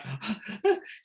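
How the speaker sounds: sharp high gasp; shocked gasp. Retold or imitated. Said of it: "She's like".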